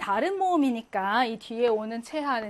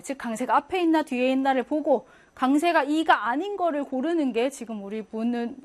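usual speaking voice

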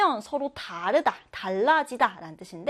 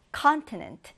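"continent" with CON stressed, falling away after; first-syllable stress; 3 semitones higher, much slower than usual. Shown as a demonstration. Teacher introducing the next word, stress on first syllable of "continent". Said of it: "첫 음절의 강세를 두고요".

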